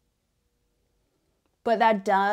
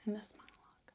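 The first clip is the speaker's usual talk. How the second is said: demonic whisper; 14 dB quieter, faster than usual. Acted. Whispered lines.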